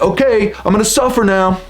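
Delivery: high, put-on melodramatic resolve; mock melodrama. Mocking the idea of choosing to suffer, melodramatic. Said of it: "that melodramatic".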